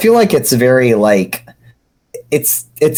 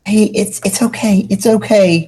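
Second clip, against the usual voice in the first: soothing higher voice; 8 semitones higher than usual. Acted. Prophet soothing Nepeta.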